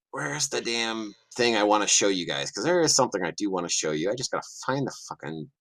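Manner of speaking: ordinary talk, nothing marked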